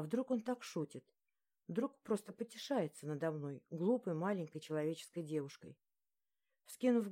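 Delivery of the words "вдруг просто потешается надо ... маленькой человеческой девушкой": ordinary delivery, nothing marked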